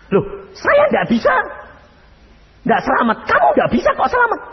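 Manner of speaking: high, fast incredulous objection; flustered protest. Retold or imitated. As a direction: protest in disbelief. Bingung memprotes jawaban Tuhan. (memprotes heran, tinggi dan cepat)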